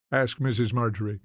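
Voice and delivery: ordinary delivery, nothing marked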